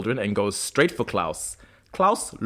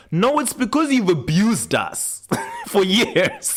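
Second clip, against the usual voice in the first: laughing retort; 9 semitones higher, slower than usual. Retold or imitated. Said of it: "And it's like".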